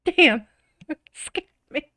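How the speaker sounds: ordinary talk, nothing marked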